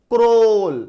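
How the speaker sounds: क्रॉल said crisply with a long aw sound; model pronunciation. Shown as a demonstration. Teacher modelling क्रॉल for students, crisp and clear. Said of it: "सीआरएडब्ल्यूएल".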